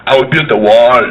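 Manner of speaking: put-on low, Austrian-accented strongman; deep, declarative Austrian accent; Arnold Schwarzenegger. Governor announcing his immigration fix, low Schwarzenegger impression. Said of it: "how Arnold handled the problem".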